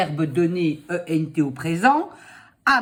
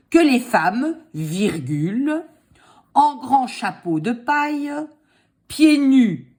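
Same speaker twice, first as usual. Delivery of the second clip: dictating; 4 semitones higher than usual. Shown as a demonstration. Dictating slowly.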